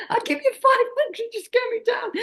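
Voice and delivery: very high, laughing falsetto begging; squeaky mock plea. Guest mocks her plea to Johnny in a laughing squeak. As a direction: squeal it like a panicked tourist.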